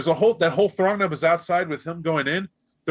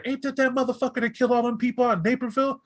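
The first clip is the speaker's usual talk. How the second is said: higher, quicker street voice taunting him; fast, raised mocking street heckle; jeering crowd voice; 7 semitones higher than usual. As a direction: jeer at the killer from the crowd.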